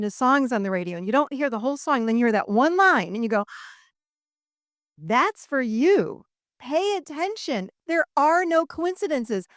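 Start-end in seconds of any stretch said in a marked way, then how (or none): 5.0-9.5 slow, higher, each word stretched out; emphatic realisation. Acted. Telling listeners to notice the signs, emphatic. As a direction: land it slowly like a revelation.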